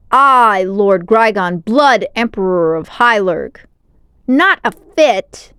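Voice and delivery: loud, slow, outraged; angry warlord. A narrator's reading. Grygon outraged at being rejected, loud and slow. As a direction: spit out each title in fury. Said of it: "huffed in anger".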